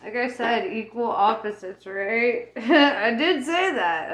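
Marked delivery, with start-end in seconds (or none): 2.6-4.1 laughing. Real, not acted. Laughs at repeating herself.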